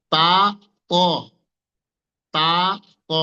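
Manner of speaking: "তা" and "ক" isolated and drawn out; contrasting letter demo. Shown as a demonstration. Teacher showing two letters sharing one makhraj, contrasting demo. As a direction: set long "তা" apart from "ক", pausing after each. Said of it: "দুইটা অক্ষর নাম আলাদা, তাদের গুণও আলাদা".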